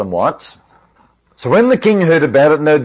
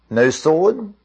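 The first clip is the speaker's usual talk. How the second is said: normal talking voice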